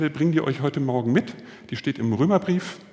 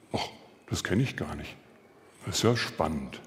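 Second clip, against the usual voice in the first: quiet wonder; 9 semitones lower, 6 dB quieter than usual. Retold or imitated. Soldier suddenly intrigued.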